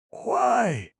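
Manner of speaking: wife astonished by her husband's outburst, surprised cry; surprised exclamation. A narrator's reading. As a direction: shoot the voice way up in surprise. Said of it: "exclaimed Missus Spring Fragrance, more and more surprised".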